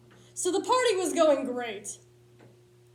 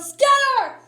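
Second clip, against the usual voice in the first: sudden high shout; yelled; 11 semitones higher, 12 dB louder than usual. Retold or imitated. Friend yelling at partygoers to flee, loud shout. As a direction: blast it out to send everyone running. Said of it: "he yelled".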